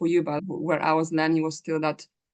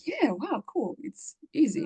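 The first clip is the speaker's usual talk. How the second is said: breezy excitement; 9 semitones higher, slower than usual. Retold or imitated. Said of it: "I'm like".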